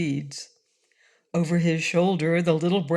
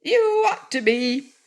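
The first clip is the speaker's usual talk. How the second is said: confident; 8 semitones higher, 4 dB louder than usual. A narrator's reading. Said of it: "was the confident reply".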